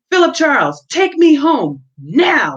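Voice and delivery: high, loud, slow angry command; furious demand. Acted. Minnie demanding Philip take her home, high and loud fury. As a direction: drag out the order in a fury. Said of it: "she demanded".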